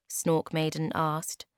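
ordinary delivery, nothing marked

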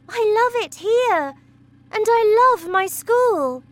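put-on girl begging her parents; pleading girl. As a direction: beg to stay.

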